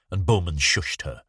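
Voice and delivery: usual speaking voice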